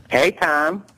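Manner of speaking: high-pitched boxer greeting; Mike Tyson. Retold or imitated. Boxer phoning the comedian, high-pitched imitation. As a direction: imitate a high-voiced phone hello. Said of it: "text message from Mike Tyson".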